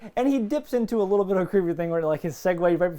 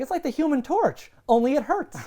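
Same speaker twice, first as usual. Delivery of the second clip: quoting Xander; 7 semitones higher than usual. Retold or imitated. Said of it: "He's like".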